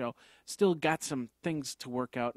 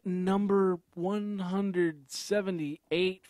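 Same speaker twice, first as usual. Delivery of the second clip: announcer voice; 7 semitones higher, slower than usual. Acted. Podcast opening over music.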